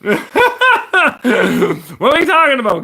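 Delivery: laughing through the question, high and loud. Real, not acted. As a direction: crack up mid-sentence in disbelief. Laughing at hat comments.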